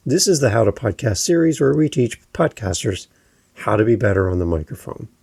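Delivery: definitive statement, pitch dropping on "microphone"; down inflection. Shown as a demonstration. Podcast coach demonstrating a definitive statement, falling inflection at the end. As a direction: let "microphone" fall firmly to close the thought. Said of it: "All of them went down in my inflection".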